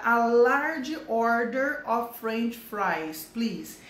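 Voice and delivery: "a large order of French fries" said very slowly and clearly; slow model sentence. Shown as a demonstration. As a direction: drill it slowly for the class. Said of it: "aqui tá".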